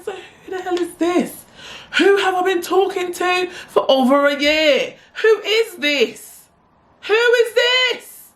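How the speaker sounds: very high, drawn-out squeal; comic high shriek, dragged out slowly; panicked disbelief. Retold or imitated. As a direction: stretch each word into a mounting high-pitched wail. Acting out her inner panic.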